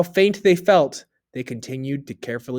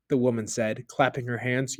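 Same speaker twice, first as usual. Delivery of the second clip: ordinary delivery, nothing marked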